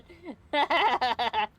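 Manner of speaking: high burst of laughter; long hearty laugh; loud real laugh. Real, not acted. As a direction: crack up loudly. Laughing out of character.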